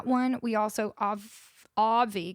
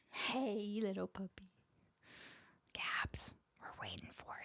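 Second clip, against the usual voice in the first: very soft, trailing into a whisper; hushed coaxing; 4 semitones lower, 17 dB quieter than usual. Real, not acted. Streamer nudging Gabs, who is choosing, hushed whisper. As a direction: coo low, then whisper.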